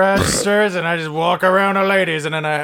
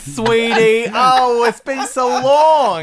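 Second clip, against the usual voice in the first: high, loud, gushing British mom; mock mom voice; 5 semitones higher, 7 dB louder, much slower than usual. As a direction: greet a long-lost child, hammed up. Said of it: "You have the same accent for all moms".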